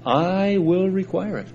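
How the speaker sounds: "I" held long and high before "will require it"; emphatic I. Shown as a demonstration. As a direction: lean hard into a long "I". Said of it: "it means it's emphatic".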